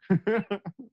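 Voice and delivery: brief amused chuckle; short laugh. Real, not acted. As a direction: chuckle at your own story. Laughs at own story.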